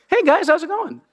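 mock-casual God greeting, high and light. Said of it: "I really want him to have a deep bass voice".

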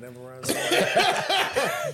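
high, drawn-out squeaky mock voice, laughing through it; mock inner voice. Friend mocks the inner voice in a squeaky laughing voice. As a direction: squeak it out slowly like a cartoon inner voice. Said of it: "My voice don't talk like that".

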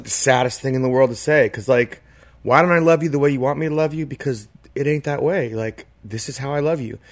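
ordinary delivery

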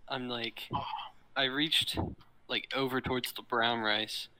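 usual speaking voice

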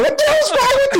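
high-pitched mock astonishment, laughing; laughing disbelief. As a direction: squeal the question through laughter. Teammates react laughing to Ichiro's outburst.

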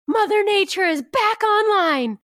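high, drawn-out triumphant yell; mock shouted announcement, stretched and high; imitated yell. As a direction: proclaim it like an ecstatic man hollering across the room.

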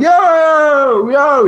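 high, loud yelled call; hollering from the sidewalk; imitated holler. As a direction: call out across the street. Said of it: "hollering at him".

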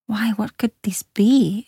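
slow, half-whispered wondering; hushed, drawn-out private thought; wondering to herself. A narrator's reading. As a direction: ask it under your breath, slowly. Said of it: "Ayla thought to herself".

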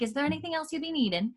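put-on a young Scottish maid offering help in a Scottish accent. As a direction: rattle it off in a light Scottish accent. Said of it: "Phaedra has a Scottish accent".

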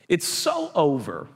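It's over, dragged out long, oh elongated; slow, drawn-out finality. Acted. Preacher to congregation, slowly drawn-out final verdict. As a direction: stretch the over out slowly, heavy fall.

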